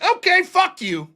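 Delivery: high, slow okay, fuck you; drawn-out brush-off; walking away from the laughing roommates; mock dismissal. Retold dismissal.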